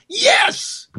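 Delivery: high, sudden yelp of joy; cheer. Real, not acted. Cheering a plan to abolish the IRS, high yelp. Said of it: "Cruz wants to abolish".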